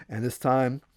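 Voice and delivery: plain reading voice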